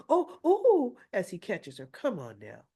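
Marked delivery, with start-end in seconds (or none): 0.1-1.0 mock startled cry. Man catching the stumbling woman.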